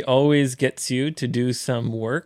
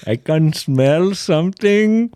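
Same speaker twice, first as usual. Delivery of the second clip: raised, louder Indian-English voice; Indian accent; 5 semitones higher, 5 dB louder than usual. Acted. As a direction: play the Indian friend, put-on accent. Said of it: "インド人のね". (インド訛りで高めに)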